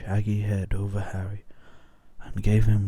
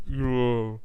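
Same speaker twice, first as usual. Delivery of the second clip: muffled voice; 3 semitones higher than usual. A narrator's reading. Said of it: "in a very muffled voice".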